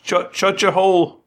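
high, loud guard snapping at a prisoner; angry guard. Acted. Guard silencing the bard, high and loud. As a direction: shout the prisoner down. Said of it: "the guard".

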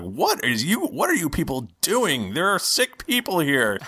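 high-pitched, scandalized scolding; acted outrage, pitch climbing as it goes; indignant cleric. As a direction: scold intruders like an appalled healer. Cleric scolds the noisy intruders.

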